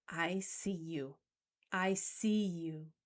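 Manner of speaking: slow, hushed acknowledgement; low-key, tender reassurance. Shown as a demonstration. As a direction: say it softly and slowly, letting them feel seen. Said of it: "with a softer tone".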